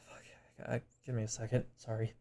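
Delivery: real tears catching in the throat, holding it together; breaking off quietly, fighting back tears; tearing up. Real, not acted. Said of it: "I don't know why I'm tearing up".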